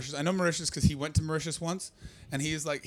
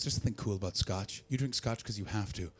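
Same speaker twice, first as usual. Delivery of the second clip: lightly voiced lecturing uncle; 7 semitones lower, 11 dB quieter than usual. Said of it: "And he goes".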